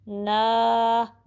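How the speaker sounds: ना held long for two counts; stretched, even ना. Shown as a demonstration. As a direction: draw out the vowel in ना. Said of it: "ना को टू count stretch करना है".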